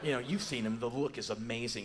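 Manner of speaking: ordinary delivery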